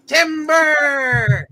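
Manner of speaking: high, loud lumberjack call; calling timber. Pretend. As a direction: yell it as the tree falls. Lumberjack calling tree fall.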